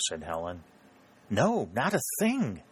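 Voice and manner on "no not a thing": mild exclamation with swooping high pitch; understated storybook exclamation; exclaiming. A narrator's reading. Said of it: "exclaimed Missus Markham".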